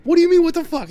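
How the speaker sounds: screeching high and loud; arguing back in a shrill retold yell; high incredulous shout. Retold argument over the kill.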